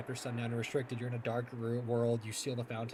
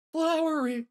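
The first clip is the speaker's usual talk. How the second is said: loud, high-pitched dismay; upset outcry; 8 semitones higher, 5 dB louder than usual. Real, not acted. Gamer protesting Flowery's death, loudly upset. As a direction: cry out at a terrible ending.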